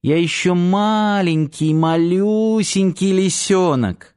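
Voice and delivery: high, slow little-cub voice; babyish cuddly voice. A narrator's reading. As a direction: coo it like a tiny fox cub. Girl plays baby fox, cuddling. (тоненько и протяжно, как малыш)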